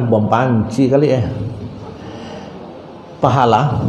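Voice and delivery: ordinary delivery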